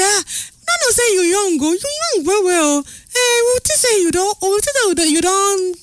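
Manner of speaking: high, playful voice of people teasing her; high-pitched teasing voice. Retold or imitated. Someone teasing the landlady that she sounds old, high and playful. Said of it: "they tell me, say".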